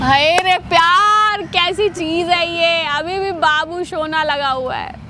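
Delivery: slow, drawn-out sarcastic sighing over love; mock lament. Wife scoffs at husband's sweet talk, mock lament. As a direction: stretch the words out in mockery. Wife mocks his pet name.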